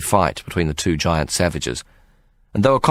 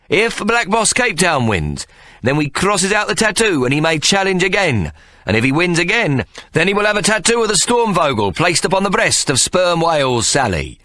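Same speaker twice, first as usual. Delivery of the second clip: ringmaster rules; 11 semitones higher than usual. Acted. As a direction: lay out the rules like a barker. Ikey sets contest rules.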